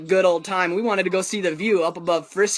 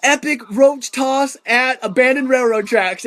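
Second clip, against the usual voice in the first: slow, higher, louder grand title; mock announcement; 5 semitones higher, 4 dB louder than usual. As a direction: proclaim it like a headline. Friend names the moment.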